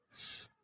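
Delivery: स as a sustained hiss, "sss"; phonic sound of S. Shown as a demonstration. Teacher asking for the S sound, answers with a hissed स. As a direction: hold स as a voiceless "sss", no vowel. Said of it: "तो S".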